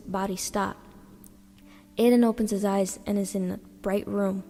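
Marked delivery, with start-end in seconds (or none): none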